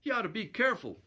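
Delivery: high, quick mild grumble; fast, lightly acted growl. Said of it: "growled Bill".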